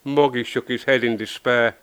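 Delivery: ordinary delivery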